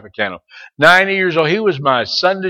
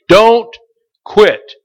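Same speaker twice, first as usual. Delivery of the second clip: higher and loud, don't hit hard; loud exhortation; 5 semitones higher, 7 dB louder than usual. Acted. Preacher urging the junior Chris, loud and high. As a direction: command it, punch Don't.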